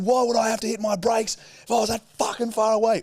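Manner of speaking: high, indignant acted shout; re-enacted road-rage yelling, pitched right up; retold screaming. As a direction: shout back in outraged disbelief. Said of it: "me and him screaming at each other".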